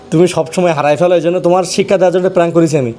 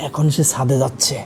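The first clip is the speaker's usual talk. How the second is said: lowered voice telling the viewers; hushed aside; 4 semitones lower, 6 dB quieter, slower than usual. Real, not acted. Husband whispering to viewers about his wife searching the roof, hushed. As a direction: quietly fill the audience in on the prank.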